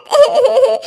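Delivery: short burst of crying; acted sobbing. Daughter-in-law unwanted by her in-laws, acted sobbing. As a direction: sob between the complaints. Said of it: "रुला दिया ना बेचारी बच्ची को".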